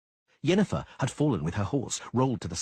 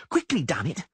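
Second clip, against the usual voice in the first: impatient shout; 7 semitones higher than usual. A narrator's reading. Dandelion impatient.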